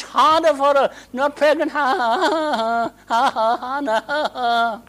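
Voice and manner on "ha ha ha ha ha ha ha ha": high mocking cackle; mocking laugh. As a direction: jeer at her with a taunting laugh. Mocking rival laughing at Hannah.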